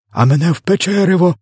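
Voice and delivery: high, indignant yell; bawling. A narrator's reading. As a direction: bawl it out like a second wronged giant. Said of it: "загорлав у горло ковтач". (обурений високий крик)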